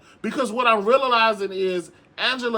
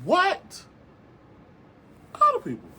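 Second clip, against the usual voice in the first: very high stunned what; incredulous high-pitched exclamation; disbelieving squeal; 10 semitones higher than usual. Real, not acted. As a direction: squeak it out in shock. Incredulous outburst.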